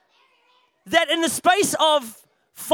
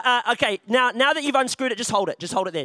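ordinary delivery

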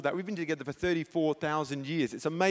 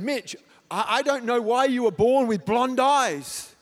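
much louder and higher, slow, fervent; anointed preaching voice; 6 semitones higher, 9 dB louder, slower than usual. Retold or imitated. Preacher tells son with a sudden anointed, loud fervour. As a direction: proclaim it over your son like anointed preaching. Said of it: "then I felt the anointing kick in".

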